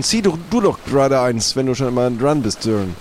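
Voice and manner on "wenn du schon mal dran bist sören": ordinary delivery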